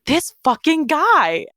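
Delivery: put-on high, slow, disgusted drawl of a sweet girl cursing; prim friend finally swearing, high and slow; mimicking Piper's disgust. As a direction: pitch it way up and stretch it out in disbelief. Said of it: "And she was just like".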